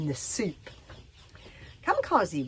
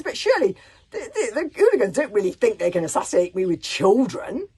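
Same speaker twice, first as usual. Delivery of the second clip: loud mocking sneer; scornful loud rant; 4 semitones higher, 10 dB louder than usual. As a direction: scoff loudly with a laugh. Chief mocks hooligans.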